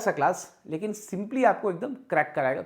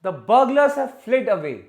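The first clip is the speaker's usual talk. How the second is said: very slow, louder wrong sentence; wrong example, slow; 4 semitones higher, 9 dB louder, much slower than usual. Shown as a demonstration. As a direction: read the faulty sentence slowly. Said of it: "away नहीं लिखेंगे".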